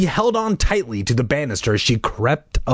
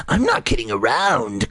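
lower, harsh insistence; harsh raspy voice; 4 semitones lower than usual. Acted. Sister stuck in the mask, harsh raspy insistence. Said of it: "insisted in her harsh, raspy voice".

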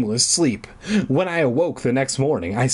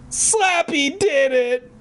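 high, drawn-out frantic cry; hammed-up high accusation; blurted out; 12 semitones higher, slower than usual. A narrator's reading. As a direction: blurt it shrilly, stretching it. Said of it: "I blurted out".

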